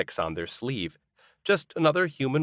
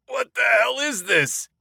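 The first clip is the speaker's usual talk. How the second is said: disgusted shout; 13 semitones higher than usual. A narrator's reading. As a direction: yell it as the liquor burns. Burning moonshine shot.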